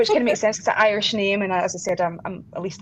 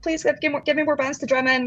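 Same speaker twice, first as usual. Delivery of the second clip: higher-pitched, mock begging; retold plea; 7 semitones higher than usual. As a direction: plead eagerly, as if quoting your younger self. Retold plea for more drumming.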